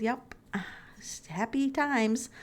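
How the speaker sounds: ordinary delivery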